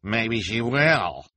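loud drawn-out sneer; snarled. Acted. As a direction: draw it out with malicious relish. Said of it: "Esme snarled".

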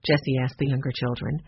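usual speaking voice

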